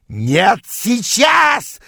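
high, drawn-out furious scream. A narrator's reading. Rival screams as he gives chase, screaming. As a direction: scream the refusal, stretching the last word. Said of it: "завопил он, бросаясь в погоню".

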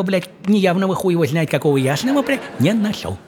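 ordinary talk, nothing marked

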